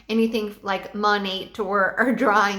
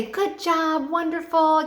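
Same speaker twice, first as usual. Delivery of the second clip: higher, cheering praise; enthusiastic praise; 6 semitones higher, slower than usual. Shown as a demonstration. Teacher showing how to praise students, enthusiastic. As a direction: cheer them on with big energy. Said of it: "you have to be very enthusiastic".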